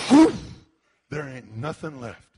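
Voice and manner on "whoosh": sudden breathy rushing whoosh; whoosh of fire. Acted. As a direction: imitate flames sweeping through. Imitates sound of rushing fire.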